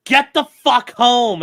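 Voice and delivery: imitating his partner summoning him; mock demand. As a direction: bark it like an impatient partner. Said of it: "two point oh is like".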